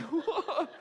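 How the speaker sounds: high, laughing stunned whoa. Retold or imitated. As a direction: let it drop out in disbelief. Parents react, laughing.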